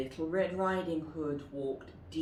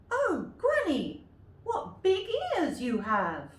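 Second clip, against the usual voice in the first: put-on very high loud girl; girl staring at granny; wondering little girl; 13 semitones higher, 5 dB louder than usual. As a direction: gasp it out in wonder. Girl questions grandmother.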